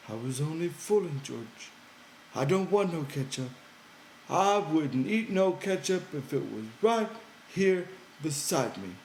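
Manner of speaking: high, eager simple-minded man; Lenny's childlike voice. Acted. Lenny coaxing George by the fire, high and pleading. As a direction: pitch it up high, pleading and earnest.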